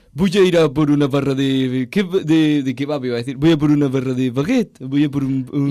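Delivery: French accent. Shown as a demonstration. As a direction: put on a French accent for the Spanish words. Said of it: "el acento francés en español".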